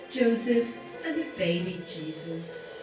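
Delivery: normal talking voice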